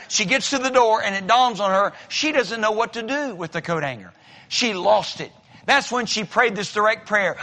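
ordinary talk

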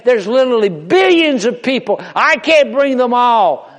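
mock complaining crowd; quoting doubters. Preacher mocking the excuse of the many, loudly. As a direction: play the people who give up. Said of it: "I hear people say".

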